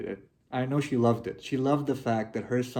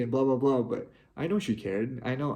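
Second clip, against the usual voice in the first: usual speaking voice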